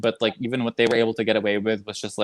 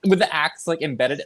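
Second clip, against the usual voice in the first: ordinary talk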